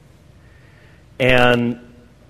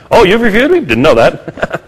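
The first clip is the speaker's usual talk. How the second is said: mock innocent surprise; 8 semitones higher, 4 dB louder than usual. Mock reply to a critic.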